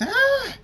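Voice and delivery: very high, loud shriek of fright; frightened scream. A narrator's reading. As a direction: shriek in sudden terror. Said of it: "The nurse screams".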